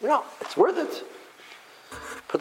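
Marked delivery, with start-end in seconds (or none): 0.0-1.0 high little seed voice; playful high-voiced seed giving in; little seed agreeing. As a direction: agree as a tiny seed, pitched up. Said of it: "So the seed says".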